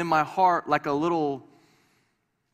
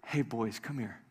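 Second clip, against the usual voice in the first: gentle call; 6 semitones lower, 10 dB quieter than usual. Real, not acted. Father calming bickering sons.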